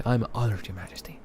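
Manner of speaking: unvoiced whisper fading out; whispered aside. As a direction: murmur to an unseen king. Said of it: "He mumbles to himself constantly".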